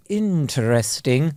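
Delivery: slow, disdainful posh 'interesting'; posh sneer. Retold or imitated. Showing how posh people dismiss you, slow sneer. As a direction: stretch it out, unimpressed and posh. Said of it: "It's that little sneery".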